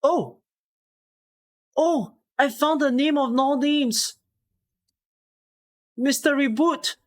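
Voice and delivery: high, elated discovery; excited exclaim. Real, not acted. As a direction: shout in triumph at finding a name. Finding a long-sought name.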